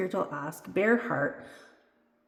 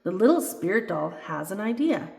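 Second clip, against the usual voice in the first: usual speaking voice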